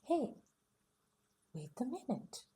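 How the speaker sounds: plain reading voice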